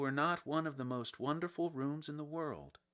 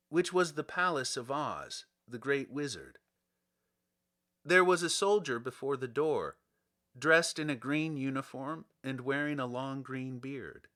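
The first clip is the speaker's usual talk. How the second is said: ordinary delivery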